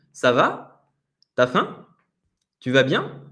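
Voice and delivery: Ça va?, T'as faim?, Tu vas bien? each rising up at the end; rising questions to repeat. Shown as a demonstration. Teacher giving phrases to repeat at home, each rising. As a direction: model each question with a clear upward finish. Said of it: "je vous invite à, à répéter ça chez vous".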